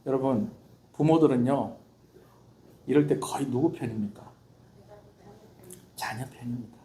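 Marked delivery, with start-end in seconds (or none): 6.0-6.8 whisper. Real, not acted. Whispered point in sermon.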